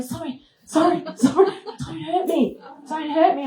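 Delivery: high, frightened apology, repeating sorry; a hammed-up frightened plea; panicky pleading. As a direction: plead high and scared with the whale. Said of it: "I'm going".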